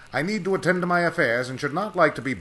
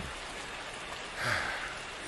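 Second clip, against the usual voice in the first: sigh; 3 semitones lower, 10 dB quieter than usual. Acted. Sigh of relief.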